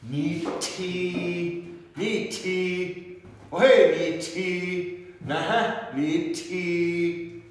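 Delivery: NI TI held long on high, level syllables; with prosody. Shown as a demonstration. Teacher demonstrating why prosody matters, with exaggerated prosody. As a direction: chant out ni ti high, each vowel drawn out. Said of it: "let's say it with ferocity".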